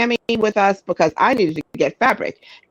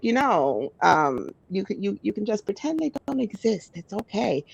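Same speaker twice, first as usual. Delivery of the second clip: softer, gentle calming words; soothing hush; 7 dB quieter than usual. Retold or imitated. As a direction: talk her down quietly. Calming an angry woman.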